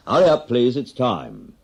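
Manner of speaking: barman's closing-time shout; pub closing call. Acted. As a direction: call last orders over the noise.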